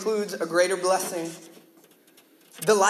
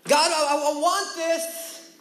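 whining; 7 semitones higher, slower than usual. Retold or imitated. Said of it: "we get entitled".

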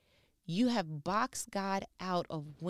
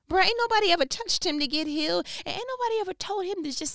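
high, loud, fired-up exclaim; bursting with amazement; excited outburst; 9 semitones higher, 6 dB louder, faster than usual. Real, not acted. Host marvels at the officer's new faith, excitedly.

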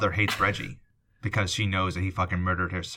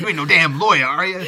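put-on high, loud cranky old-timer voice; crusty old prospector; 8 semitones higher, 8 dB louder than usual. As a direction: grouse like a grumpy backwoods prospector. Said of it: "The old prospector".

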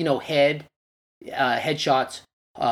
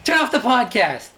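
laughing; 6 semitones higher, 4 dB louder than usual. Real, not acted. Laughing at his own joke.

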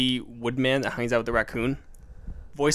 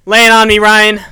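booming, much louder and higher; 7 semitones higher, 15 dB louder than usual. Real, not acted. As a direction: call it out big and loud. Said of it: "talking too loud".

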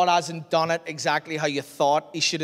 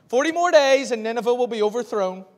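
raised, higher herald's cry; proclaiming loudly; 7 semitones higher, 6 dB louder than usual. Acted. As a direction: call it out like a prophet in the streets. Jonah proclaiming to the city.

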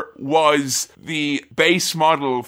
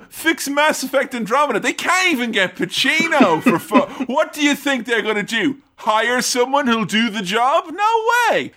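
higher-pitched, incredulous company spokesman; mock indignant rant; 7 semitones higher than usual. Voicing EA refusing to fix things, mock indignant. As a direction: climb into a scoffing, hammed-up refusal. Acting out EA's dismissive outburst.

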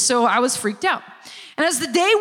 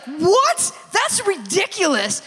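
higher-pitched retold disbelief; incredulous friend; 5 semitones higher, slower than usual. Best friend hears they're going to church, incredulous. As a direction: act out a scandalized best friend.

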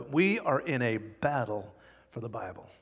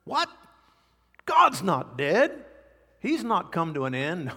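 mock confusion; 3 semitones higher than usual. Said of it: "You say".

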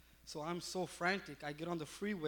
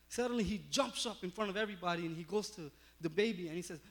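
ordinary talk, nothing marked

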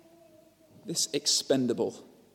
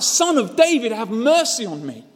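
much higher, loud pleading shout; desperate cry; 10 semitones higher, 7 dB louder than usual. A narrator's reading. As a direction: beg the king not to pass you by. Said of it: "he cries out".

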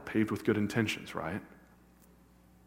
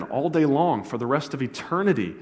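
rising to a shouted climax, louder and higher; fiery preaching; 4 semitones higher, 6 dB louder than usual. Real, not acted. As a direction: drive it home to the congregation at full force. Preaching at full force.

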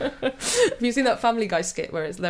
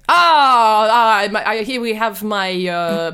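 hammed-up French waiter accent, louder; big theatrical French welcome with a laugh; 7 dB louder than usual. Waiter introducing Jean-Claude the sommelier, loud French accent.

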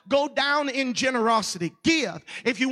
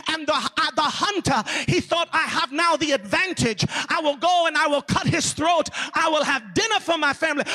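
imitated Zulu preacher, African accent; strong African accent; 3 semitones higher than usual. Zulu pastor revealing the buffalo's advantage, African accent. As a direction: drive the story on in a put-on African accent. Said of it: "with that strong African accent".

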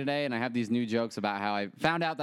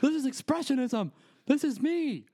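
dramatic artiste stripper; 10 semitones higher than usual. Pretend. Stripper on the pole proclaims her artistry, high and grand. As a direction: proclaim it like misunderstood art.

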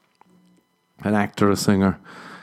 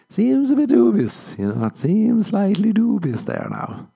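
dubious; 12 semitones higher than usual. Acted. Girl doubting a man's claim in an alley, dubious. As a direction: squeak out your suspicion in a much higher voice. Doubting reply to imagined unsafe offer.

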